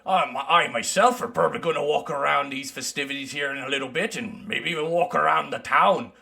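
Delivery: lightly voiced mountain dwarf; easygoing dwarf traveler.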